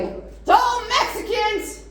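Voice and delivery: high, slow mock-alarmed old man; outraged grandpa. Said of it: "and he was like".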